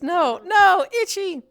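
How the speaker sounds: high, loud yelp of a cover story; retold panicked shout. Caught scratching as mom walks in, retold shout. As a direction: imitate blurting it out in a high, loud voice. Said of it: "All I could manage to shout was".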